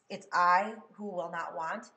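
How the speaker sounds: I punched loud and drawn out; stress on I. Shown as a demonstration. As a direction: lean hard and slowly on the word I. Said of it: "I want to have stress that I".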